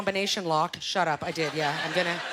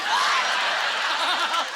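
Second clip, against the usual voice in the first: mock eager what; 7 semitones higher, 5 dB louder than usual. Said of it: "I'm like".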